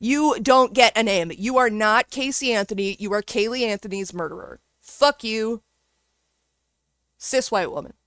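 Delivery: ordinary delivery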